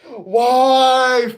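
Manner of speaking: loud, high cry of protest; hammed-up drawn-out yell; frustrated wail. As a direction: wail it out in exasperation. Frustrated at the mishap.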